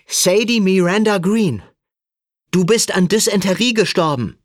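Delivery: loud, high shout across a crowd; shouting to be heard. A narrator's reading. Sam shouts to Sadie across the station, calling out. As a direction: yell it so she finally turns round. Shouting to make friend turn round.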